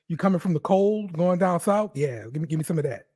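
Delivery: ordinary delivery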